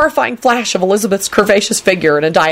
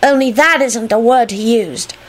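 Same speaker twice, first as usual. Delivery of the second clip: self-righteous tattletale, pitched high; 8 semitones higher than usual. A narrator's reading. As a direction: tell on them primly in a high voice. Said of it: "he finished self-righteously".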